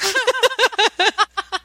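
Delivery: a high burst of laughter. Real, not acted. Laughing at asking her burned husband about his beard, high. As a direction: laugh at her own vain question, high and light.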